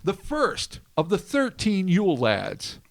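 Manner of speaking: announcer voice. Acted. Announcing the Yule lads.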